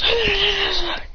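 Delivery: high, drawn-out mock effort noise; high wail as if blocking blows; strained cry.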